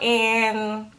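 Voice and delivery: ordinary talk, nothing marked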